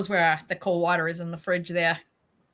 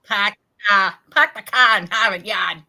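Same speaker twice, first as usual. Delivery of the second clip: put-on louder Boston accent with dropped r's; 8 dB louder than usual. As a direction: do the Harvard Yard line in Boston accent. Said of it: "There's the New England accent".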